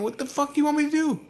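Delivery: high-pitched helpless retort; exasperated protest. Retold or imitated. Son answering father who flips on him, exasperated protest. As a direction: plead in mimicked exasperation.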